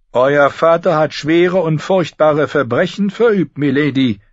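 high, loud solemn intoning; intoning maester. A narrator's reading. Grand Maester Pycelle condemns Sansa's father, intoning. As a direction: intone it like an old sage. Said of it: "intonierte Großmeister Pycelle".

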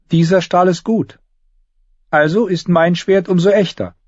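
put-on high girlish voice, insisting; high-pitched proud little girl; young girl Arya, proud. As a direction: argue back in a high child's voice.

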